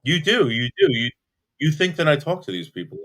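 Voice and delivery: accusing. Real, not acted. Accusing the caller of judging him, heated. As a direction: throw it back at them. Arguing on a call.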